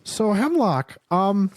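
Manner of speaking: dad voice rising high and slow on rollerblades; nervous dad reaching for a cool word; awkward dad voice. Acted. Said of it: "I like how you do my dad's voice".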